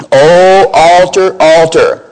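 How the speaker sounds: slow and loud, the O altar stretched out; emphatic prophet's cry. Acted. Prophet crying out against the altar, slow and loud. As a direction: call out O altar, altar like a prophet's doom. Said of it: "You see the emphasis there".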